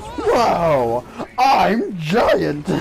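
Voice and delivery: high, loud, laughing "whoa, I'm giant"; gleeful shout of discovery; excited exclaim. Retold or imitated. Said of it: "she goes".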